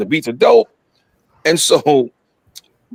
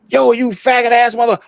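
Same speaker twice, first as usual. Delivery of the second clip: mock outburst, pitched up; retold angry yelling; 7 semitones higher than usual. Storyteller replaying his outburst at Puff, a mild mock yell. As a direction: replay the yell without full volume. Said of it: "I'm screaming, I'm yelling".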